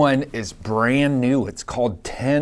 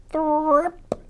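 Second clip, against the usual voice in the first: much higher, slow squeaky thrip; squeaky meerkat sound. A narrator's reading. Reading to a child, meerkats make a high squeak. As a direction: pipe it up like a tiny meerkat.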